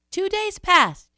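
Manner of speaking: high, loud, drawn-out. A narrator's reading. Storyteller counts sunless days, high and emphatic. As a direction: lift it way up and stretch it.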